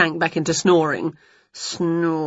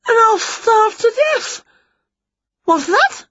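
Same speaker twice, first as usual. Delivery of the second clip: despairing witch; 3 dB louder than usual. Acted. Witch lamenting her lost tooth to her cat, high and whiny. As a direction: moan as if the world is ending.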